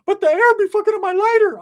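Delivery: very high, loud squeaky friend voice; Ricky's squeaky voice. Retold or imitated. Ricky explaining himself to the chick, very high and loud. Ricky complaining about the lighter.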